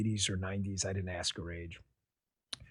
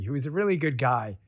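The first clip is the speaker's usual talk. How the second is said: normal talking voice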